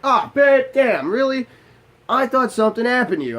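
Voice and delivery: very high, loud exasperated cry; exasperated relieved outburst. Retold or imitated. Husband realizes nothing is wrong, shouting in relief. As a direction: let the relief burst out as a near-yell.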